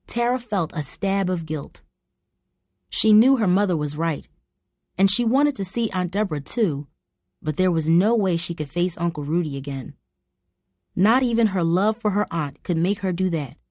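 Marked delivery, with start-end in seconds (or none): none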